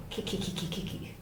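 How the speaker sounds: quiet monkey chatter; soft kiki chatter from a treetop. Acted. Monkey chattering from the palm top, quiet. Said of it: "Monkey chattered".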